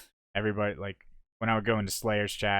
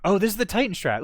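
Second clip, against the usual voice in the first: excited; 10 semitones higher, 6 dB louder, faster than usual. Real, not acted. Spotting his strat on replay.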